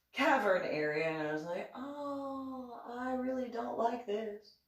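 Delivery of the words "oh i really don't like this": uneasy reluctance. Retold or imitated. Retelling unease in the cavern.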